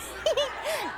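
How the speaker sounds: high gleeful giggle; high triumphant little laugh; pleased laugh. Acted. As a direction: laugh at the successful scheme. Laughs at own trick.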